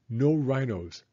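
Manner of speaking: plain reading voice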